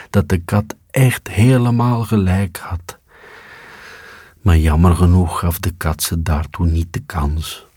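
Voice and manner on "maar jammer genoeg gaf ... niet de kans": usual speaking voice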